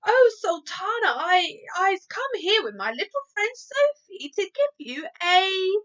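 high, loud gushing giant voice; a giant bursting with eager praise; crying out excitedly. Acted. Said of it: "cried the B F G".

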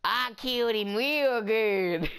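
slow, drawn-out Southern brag; Southern accent. Acted. Bragging to Daddy about a kill, Southern accent. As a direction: boast with a hammed-up Southern drawl. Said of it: "That's my southern accent".

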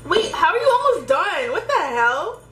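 shocked outburst; surprised. Real, not acted. Shocked he is almost done.

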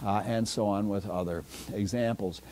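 ordinary delivery

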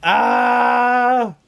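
loud high scream; frantic loud scream. Real, not acted. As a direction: yell out as the hit lands. Attacked, screams.

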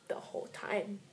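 whispered. Acted. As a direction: whisper the whole time, voiceless and soft. Same line retaken.

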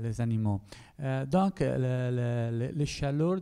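Italian speaker's accent in French; French spoken with a non-native Italian accent; foreign accent in french. Real, not acted. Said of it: "excuse-moi mon français".

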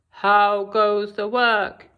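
low, slow farmer call; lazy farmer calling. Acted. Lazy farmer calling from bed to the duck.

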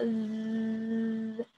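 soft long buzzing zzz to show the vibration; soft drawn-out buzz. Shown as a demonstration. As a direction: hum a quiet drawn-out z. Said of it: "eu sinto vibrar aqui".

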